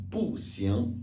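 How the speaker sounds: "bu xiang": falling "bu", then "xiang"; unchanged bu. Shown as a demonstration. Teacher shows bu reste tel, falling "bu" before "xiang". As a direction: drop "bu" sharply before "xiang". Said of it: "Le bu reste tel".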